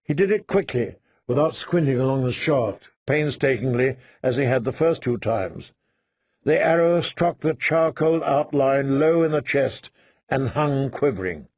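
ordinary delivery, nothing marked

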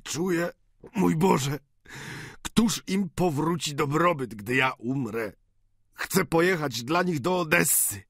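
feverish dying father. Acted. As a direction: plead in a delirious rush of hope. (gorączkowe, wzburzone błaganie umierającego ojca)

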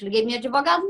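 ordinary talk

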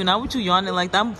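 usual speaking voice

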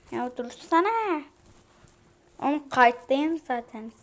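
real angry yelling at a glitching phone; furious at phone. Real, not acted. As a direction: shout at the frozen phone to stop. Phone freezing while recording, yelling.

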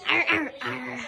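low, soft pirate growl; lightly voiced pirate animatronic; pirate Foxy growl. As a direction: growl it like a pirate fox robot. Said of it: "only Foxy talks like that".

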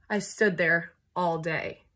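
usual speaking voice

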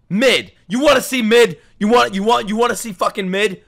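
high, loud, indignant repetition; riled-up threat. Real, not acted. Streamer challenging whoever said mid, angry shout. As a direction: throw the word back as a heated challenge.

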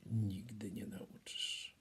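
unvoiced whisper of a harsh verdict; hushed nightingale whisper. A narrator's reading. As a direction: breathe it out, no voice.

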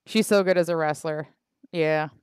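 normal talking voice

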